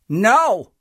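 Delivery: high loud no; firm refusal. A narrator's reading. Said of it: "And Max said".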